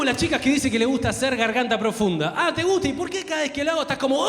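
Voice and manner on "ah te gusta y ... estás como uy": mocking disbelief. Mocking girls claiming to enjoy.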